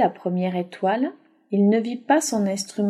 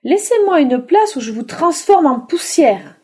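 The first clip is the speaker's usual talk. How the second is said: higher, louder witch's threat; menacing witch; 6 semitones higher, 5 dB louder than usual. Acted. Witch threatening to turn them to dust.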